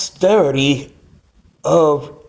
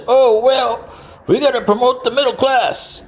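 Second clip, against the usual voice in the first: lightly voiced, higher mocking politician; a hint of a preachy candidate; 9 semitones higher than usual. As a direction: parody a campaign promise, higher.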